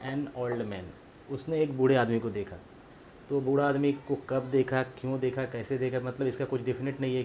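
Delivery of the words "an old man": very slow, every word apart; "he saw an old man" read out slowly for the class; slow example reading. Shown as a demonstration. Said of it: "इसके examples देख लेते हैं". (उदाहरण को बहुत धीरे-धीरे पढ़ना)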